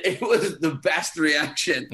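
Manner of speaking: loud, high, slow through real laughter; laughing. Real, not acted. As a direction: crack up while telling it. Laughing at his own prank story.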